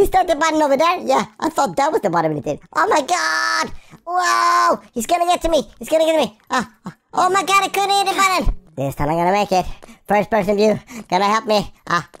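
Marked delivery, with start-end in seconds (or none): 2.7-8.6 high-pitched game panic; playful panic. Pretend. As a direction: cry out as if being caught. Chased, missing the button.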